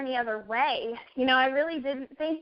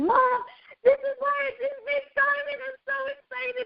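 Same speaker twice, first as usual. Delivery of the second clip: high, tearful, excited cry to mom; high weepy thrill; tearful excitement; 12 semitones higher than usual. Retold or imitated. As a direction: squeal it through tears to mom. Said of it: "and I said".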